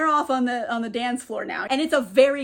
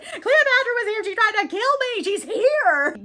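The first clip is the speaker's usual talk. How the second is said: high, louder frantic outburst; mock panic; 9 semitones higher, 4 dB louder than usual. Julie telling Ramses who attacked her, acted panic. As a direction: imitate someone hysterical, pitched way up.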